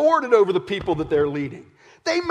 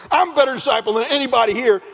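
louder, faster bragging; loud boast; 4 semitones higher, 7 dB louder, faster than usual. Acted. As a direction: brag fast and loud to the room. James boasting over the others.

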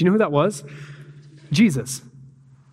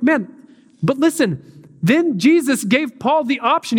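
arguing back; 8 semitones higher than usual. Retold or imitated. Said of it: "And I'm like".

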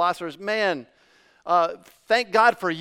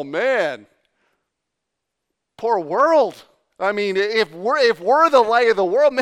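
stunned fishermen; 3 semitones higher, 5 dB louder than usual. Retold or imitated. Fishermen answering Jesus, amazed and disbelieving. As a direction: play a humble fisherman who can't believe his ears. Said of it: "They're like".

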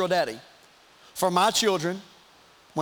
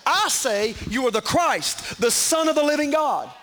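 slow, ringing proclamation, raised in pitch; bold declaration; 6 semitones higher, 4 dB louder than usual. Acted. Peter steps out in boldness to confess the Christ, bold declaration. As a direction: declare it boldly, drawing out the words. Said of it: "step out in boldness and say".

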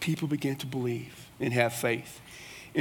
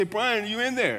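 raised-pitch call, acted out; calling through door; 7 semitones higher than usual. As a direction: check if a colleague is behind the door. Acting out calling through office door.

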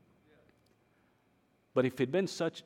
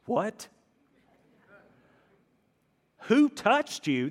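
much higher and louder, slow and disbelieving; incredulous disciples; 9 semitones higher, 4 dB louder, much slower than usual. Acted. Disciples to Jesus, baffled by his question in the crowd, high and loud. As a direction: squeal it like you can't believe the question. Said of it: "And the disciples like".

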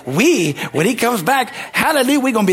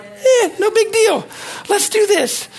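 high, slow, breezy shrug of a voice; casual God voice; slower than usual. Retold or imitated. Pastor voicing God as totally unbothered, breezy and high. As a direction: play God shrugging it off with a laugh.

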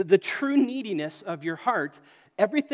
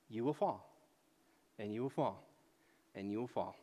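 each repeat lower and softer, dying away; fading warning; 7 semitones lower, 9 dB quieter than usual. Acted. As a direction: let the warning sink to almost nothing.

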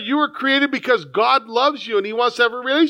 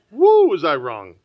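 rueful exclaim; 5 semitones lower, 4 dB louder than usual. Real, not acted. Pastor laughs at his past.

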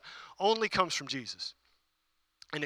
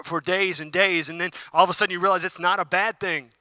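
ordinary talk

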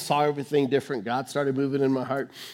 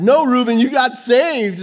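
louder, higher insisting wife; raised voice of an exasperated wife; insistent retold shout; 9 semitones higher, 9 dB louder than usual. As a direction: push it, she won't let it go.

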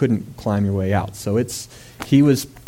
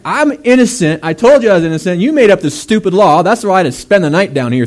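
loud, higher, exasperated scolding; indignant Daniel; 8 semitones higher, 8 dB louder than usual. Retold or imitated. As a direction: throw the blame back at the king.

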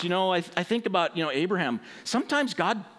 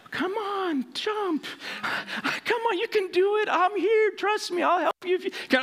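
coaxing instructor; 12 semitones higher than usual. Retold or imitated.

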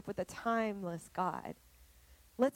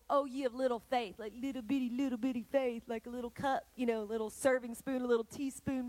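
mocking tiny faith; 2 semitones higher, faster than usual. Shown as a demonstration. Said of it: "it's not that kind of measure".

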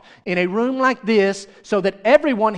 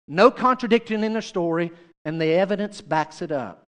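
ordinary delivery

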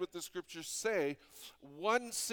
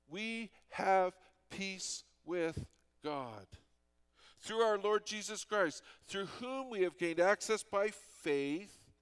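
slow, louder solemn reading; scripture reading; 3 semitones higher, 4 dB louder than usual. Acted. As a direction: proclaim the verse with weight. Reads scripture aloud.

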